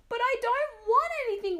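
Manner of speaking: very high, loud wail; girl pleading with her mother. Acted. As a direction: wail it like a tearful child. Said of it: "wailed Mona".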